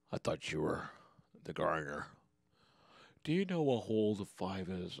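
lightly voiced condescending golfer; mock golfer.

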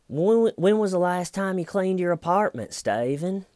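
high, exaggerated detective voice; hammed-up prying question; mock interrogation. Imitates detective grilling suspect.

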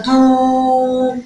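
دو with the oo held long and level; sustained دوووو; strongly stretched vowel. Shown as a demonstration. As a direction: draw out the vowel of دو on one note. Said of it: "بمد قوي".

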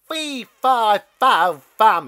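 booming bellowed chant; a mean stalk crying out in space; loud cry. Acted. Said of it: "it cried".

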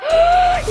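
high sharp gasp of delight; excited gasp. Real, not acted. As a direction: gasp at the good news. Seeing poll results.

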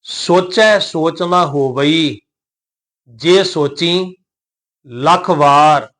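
slow recitation with drawn-out vowels; scripture recitation. Shown as a demonstration. Teacher models the verse for learners, slow recitation. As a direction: recite phrase by phrase, stretching the endings.

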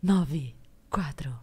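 low, slow, breathy announcer voice; airy departures-hall announcement, slowly; airport announcement. Shown as a demonstration. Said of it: "Ah, faz pra gente!".

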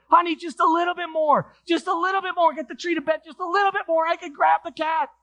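very high, urgent acted call; mom yelling out. Mom urging her husband to inch forward, high yelled plea. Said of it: "she yelled out".

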